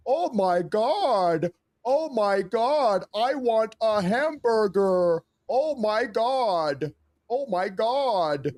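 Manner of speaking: very high, very slow, gushing caricature of an American tourist; hammed-up high-pitched American voice, drawn out slowly; mock American impression. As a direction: stretch every word way up high like a gawking tourist. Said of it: "give me your best American impression".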